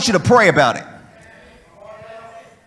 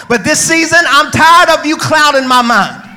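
driving cadence rising to shouted peaks; full-force preaching; 6 dB louder than usual. Real, not acted. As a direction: preach it hard to stir the congregation.

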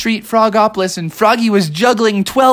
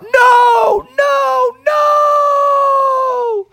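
high, desperate shouts of no; Froggy hollering after the moose, very high; much slower than usual. Acted. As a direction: cry out as your ride disappears. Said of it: "Froggy was hollering out".

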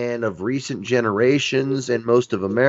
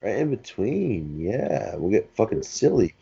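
normal talking voice

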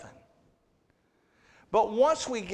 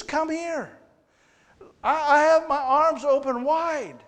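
inviting God voice; 9 semitones higher, 7 dB louder than usual. Retold or imitated. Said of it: "He says".

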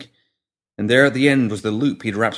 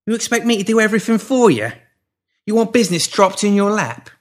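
menacing pimp; 12 semitones higher, 5 dB louder than usual. A narrator's reading. Pinky berating the woman.

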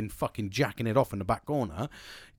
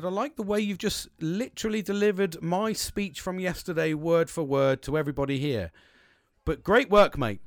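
higher-pitched, pointed mock compliment; sarcastic praise; 6 semitones higher, 3 dB louder than usual. Worker calling out James for copying his speech, sarcastic praise. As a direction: lift the pitch and congratulate him pointedly.

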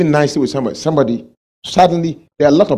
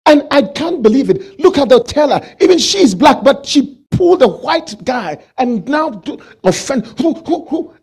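much higher, louder outraged rant; mock the offended customer's outrage; imitating an offended man at the bank, high and loud; indignant customer; 10 semitones higher, 3 dB louder than usual.

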